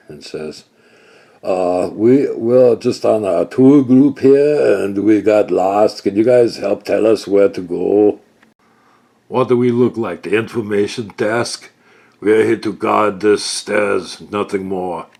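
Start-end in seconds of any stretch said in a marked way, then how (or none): 1.4-8.2 loud, slow, overly earnest; bluffing tourist. Acted. Kaylor bluffs the stair guards, loud and slow. 9.3-15.1 loud, curt and dismissive; gruff guard. Acted. Stair guard rebuffs Kaylor, loud and gruff.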